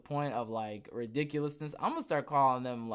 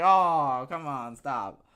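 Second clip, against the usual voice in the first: exasperated; 8 dB louder, much slower than usual. Acted. Acted exasperated reply to a disappointing claim.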